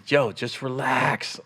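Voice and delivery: soft, slow calming words; gentle, drawn-out reassurance; retold reassurance. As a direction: talk a nervous friend down, slowly and quietly. Retells calming a nervous friend.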